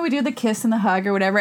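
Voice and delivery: usual speaking voice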